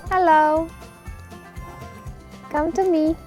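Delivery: high, loud coaxing calls to an animal. Real, not acted. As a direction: coax the cute animal over, sing-song. Calling a cute animal over.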